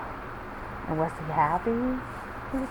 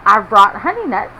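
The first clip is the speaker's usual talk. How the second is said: 1 lightly voiced Badger; 10 dB louder than usual. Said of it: "Badger says with a grin".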